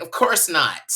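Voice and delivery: mock emphatic denial; bold-faced lie. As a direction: lie brazenly with fake indignation. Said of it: "I'm like".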